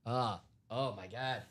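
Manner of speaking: quiet, choked-up voice, near tears; fighting back tears on stream; tearing up. Real, not acted. Host moved by coworker's engagement news, softly tearing up. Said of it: "I am tearing up right now".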